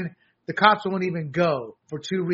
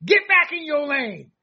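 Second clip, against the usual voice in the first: scolding party; 7 semitones higher, 4 dB louder than usual. Retold or imitated. Party scolding the mayor, loud high reprimand. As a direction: snap her back into line. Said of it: "It was like".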